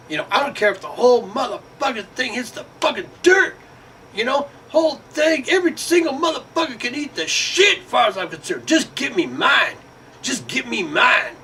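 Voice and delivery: high, hammed-up careless voice; mock selfish voice. Voicing people who only want their share, high mocking voice.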